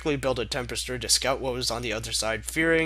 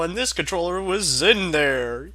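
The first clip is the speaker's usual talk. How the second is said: ordinary delivery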